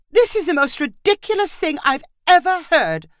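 high-pitched, exasperated outburst; indignant cry. A narrator's reading. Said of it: "Marissa cried".

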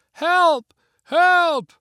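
high, loud distant cries; cries for help. A narrator's reading. Family below crying for help, loud and high. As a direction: call up from far below.

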